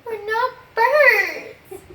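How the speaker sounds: slow, breaking into laughter; can't keep a straight face; laughing through it. Real, not acted. Teacher cracking up.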